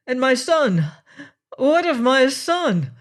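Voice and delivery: a father fearing for his son, crying out; anxious cry. A narrator's reading. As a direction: cry it out, desperate for news. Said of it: "he cried out".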